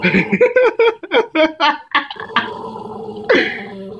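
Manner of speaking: long high-pitched giggling laugh; high giggle. Real, not acted. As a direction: giggle helplessly. Laughing at dog.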